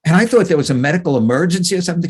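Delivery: ordinary delivery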